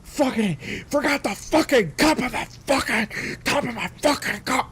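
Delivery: high, loud furious rant; mock screaming. Driver ranting into her speakerphone, high loud scream. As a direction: scream it into the phone. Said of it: "she screams into her fucking phone".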